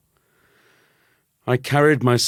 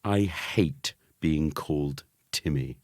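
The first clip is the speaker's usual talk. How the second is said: plain reading voice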